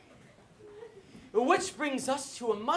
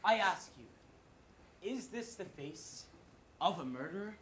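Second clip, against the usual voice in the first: hushed solemn; 3 semitones lower, 7 dB quieter, slower than usual. Acted. Mock earnest solemn plea.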